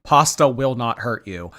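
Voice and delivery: normal talking voice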